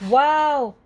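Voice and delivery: loud, high amazed wow. A narrator's reading. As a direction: gasp it out in wonder. Pete amazed at the caboose.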